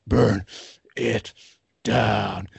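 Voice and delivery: low, drawn-out words with a pause after each; mock menace, one word at a time; retold menacing catchphrase. Imitating wrestler's catchphrase, low and deliberate. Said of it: "and he goes".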